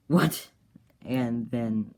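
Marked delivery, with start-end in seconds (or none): 0.1-0.5 high, loud shocked cry; a startled gasped question. Retold or imitated. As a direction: blurt it out in disbelief. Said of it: "he's like".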